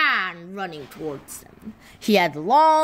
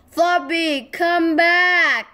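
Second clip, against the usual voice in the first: high, loud call after the dog; calling yell; 8 semitones higher, 5 dB louder, slower than usual. Acted. Biff calling the fleeing dog back, yelling. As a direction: shout after a runaway dog. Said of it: "yelled Biff".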